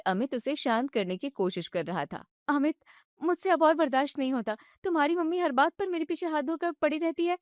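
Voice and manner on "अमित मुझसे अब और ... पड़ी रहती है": higher, fast, agitated wife; upset young wife. Acted. Wife vents to her husband about his mother, agitated and high. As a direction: vent it all at once.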